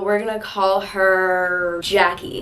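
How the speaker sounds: slow, stretched-out delivery; drawn-out name reveal. Shown as a demonstration. Naming the girls for the story.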